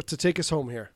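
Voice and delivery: ordinary delivery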